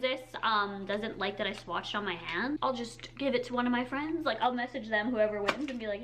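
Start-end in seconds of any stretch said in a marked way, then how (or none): none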